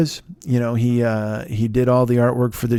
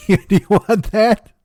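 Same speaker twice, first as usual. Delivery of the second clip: higher, words breaking into a real laugh; 7 semitones higher, 4 dB louder, slower than usual. Real, not acted. Host joking about a painting of your mom, laughing. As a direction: crack up at your own joke. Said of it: "That made me laugh".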